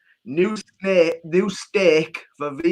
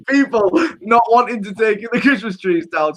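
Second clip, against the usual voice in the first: normal talking voice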